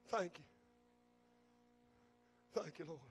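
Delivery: soft, low, hushed thank you; quiet, low reverent thanks. Acted. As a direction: drop to a near murmur of gratitude. Quiet echo after shouted thanks.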